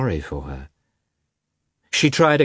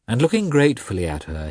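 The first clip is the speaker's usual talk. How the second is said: ordinary delivery, nothing marked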